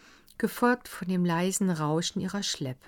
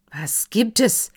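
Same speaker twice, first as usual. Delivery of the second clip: higher, loud call down the stairs; 4 semitones higher, 8 dB louder than usual. A narrator's reading. Herr von Wallbaum calls down the stairs loudly. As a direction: demand to know, loudly. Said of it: "rief Herr von Wallbaum".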